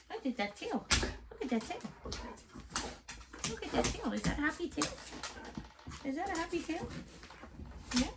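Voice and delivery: high, sing-song cooing at the dog; high sweet pet voice for the dogs. Real, not acted. Baby talk to the dogs.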